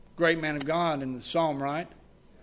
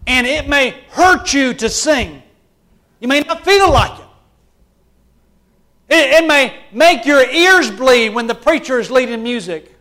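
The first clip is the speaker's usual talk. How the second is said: higher, louder preaching with slowed key lines; full-force preaching; 9 semitones higher, 11 dB louder, slower than usual. Acted. Preacher rousing his church about the raging soul, loud and impassioned. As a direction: drive it home like a fired-up preacher.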